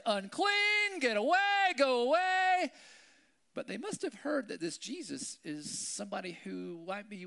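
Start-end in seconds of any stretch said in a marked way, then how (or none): 0.0-2.7 high, loud shouted warning; yelled warning. Retold or imitated. Lepers ringing bells shout people away, high and loud. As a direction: shout them away from far off. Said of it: "ring their little bells and say".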